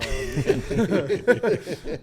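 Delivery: bright burst of laughter; a real laugh at the question. Real, not acted. A kicker laughing as he starts answering.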